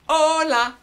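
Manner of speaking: hola sung high and loud; loud call. Shown as a demonstration. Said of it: "I'll sing 'em".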